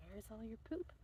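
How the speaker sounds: soft whispered puzzled question to the mouse; whispering to the mouse while looking for the poop. Retold or imitated.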